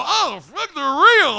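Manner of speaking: high, loud amazed cry; excited exclamation. Acted. Boy seeing real breasts for the first time, excited exclamation. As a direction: blurt it out in hammed-up boyish amazement. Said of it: "and I go".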